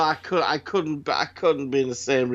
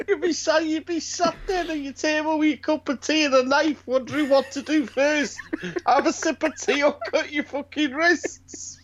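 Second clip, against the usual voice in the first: higher, mock Yorkshire drama voice; Yorkshire accent; 11 semitones higher than usual. Mocking a grim Yorkshire drama, in a high Yorkshire accent. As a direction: lay on a bleak Yorkshire accent, pitched up. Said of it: "Fucking outstanding Yorkshire accents".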